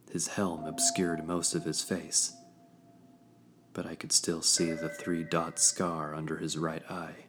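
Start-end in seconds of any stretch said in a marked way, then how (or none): none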